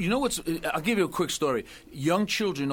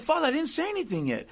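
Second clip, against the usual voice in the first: mock protest; 10 semitones higher than usual. Baffled penitent protests to priest.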